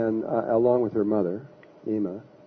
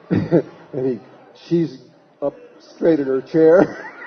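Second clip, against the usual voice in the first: words pushed out through laughter; can't stop laughing as you tell it; laughing while talking; 3 semitones higher, 7 dB louder, much slower than usual. Real, not acted. Recounting the skeptic's comeuppance, laughing through words.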